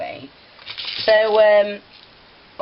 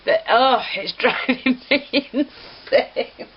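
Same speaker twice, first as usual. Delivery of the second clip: higher, slow words broken by real giggles; laughing; 8 semitones higher, much slower than usual. Real, not acted. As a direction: fight the giggles while talking. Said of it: "don't get me laughing".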